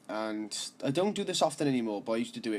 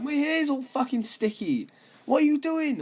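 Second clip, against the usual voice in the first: put-on outraged girl; 5 dB louder than usual. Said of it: "She was like".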